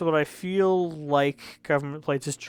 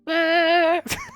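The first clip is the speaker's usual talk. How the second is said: high, loud held noise; imitated song noise; 5 dB louder than usual. Reviewer mimicking a hated track, high blaring noise. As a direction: blare one high sustained tone.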